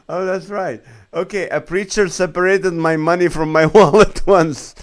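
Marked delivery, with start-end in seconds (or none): 1.1-4.8 laughing. Real, not acted. Reading a funny comment aloud.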